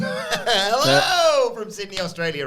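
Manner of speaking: high, loud, long-drawn hello; shouted greeting. Acted. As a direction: holler the greeting, stretching hello. Reading greeting from Australian listener.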